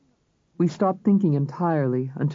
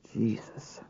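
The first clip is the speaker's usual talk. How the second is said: much softer muttered oath; muttered under breath; 4 semitones lower, 10 dB quieter than usual. A narrator's reading. Mark after the deputy leaves, muttering softly. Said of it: "Mark muttered under his breath".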